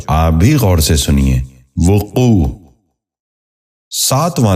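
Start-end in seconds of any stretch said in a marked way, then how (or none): 1.9-2.5 وقوع said as "vu-qoo", short u after v; correct pronunciation. Shown as a demonstration. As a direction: open وقوع with "vu". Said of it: "درست تلفظ ہے".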